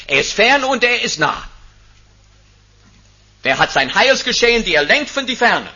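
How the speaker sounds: loud, high rising proclamation from the pulpit; preaching at full force. Acted. Preacher to congregation, proclaiming the fourfold blessing at full force.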